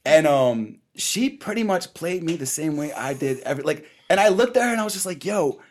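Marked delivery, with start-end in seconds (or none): none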